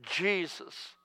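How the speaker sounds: breathy near-whisper plea; a desperate whispered prayer to Jesus; desperate cry. Retold or imitated. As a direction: pray it in a hushed, desperate whisper. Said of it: "And I cried out".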